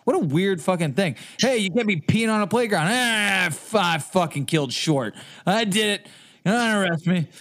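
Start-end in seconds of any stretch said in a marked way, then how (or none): 1.4-7.3 high, hammed-up confession to a cop; mock protest in a raised voice; mock confessor. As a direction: play a silly suspect blurting a confession, pitched up. Acts out arrest and false confession.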